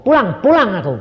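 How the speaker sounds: high, loud retold call; retold call home. Retelling a call to go home, called out. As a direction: call it out loudly, a little high. Acted calling out going home.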